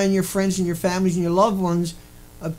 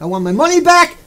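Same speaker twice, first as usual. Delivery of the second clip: higher and louder, insistent; loud demand; 6 semitones higher, 6 dB louder than usual. Pretend. Audience member demanding a refund from lecturer, loud. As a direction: raise the voice to demand it. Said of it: "say it nice and loud".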